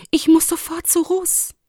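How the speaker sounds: put-on high, urgent young character voice. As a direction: blurt it high, needing to go now. Lumi urgently wants to leave. (dringlich, mit hoher Stimme)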